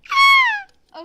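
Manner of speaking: shrill loud shriek of joy; delighted scream. Real, not acted. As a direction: let out a huge squeal.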